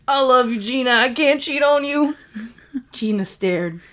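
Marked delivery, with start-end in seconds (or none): 0.1-2.2 high, loud, pleading outburst; desperate confession. Acted. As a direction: blurt it out, begging her to believe. Kyle's desperate confession to Gina.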